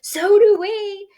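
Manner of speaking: very high eager voice; high little critter; eager squeak. Acted. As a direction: squeak it excitedly. Eager prairie dogs.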